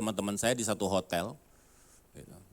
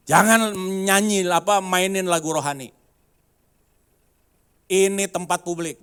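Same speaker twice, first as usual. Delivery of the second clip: angry shout; 9 semitones higher, 10 dB louder than usual. Retold or imitated. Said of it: "dia bilang".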